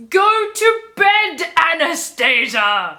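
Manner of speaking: slow, high, loud shout; shouty capitals. Acted. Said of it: "He replies in capitals".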